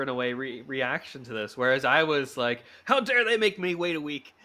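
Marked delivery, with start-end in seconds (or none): 2.8-4.3 high, indignant mock fury with a laugh; comic high-pitched indignation; mock outrage. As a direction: play up the outrage, half laughing. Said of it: "I turned to anger".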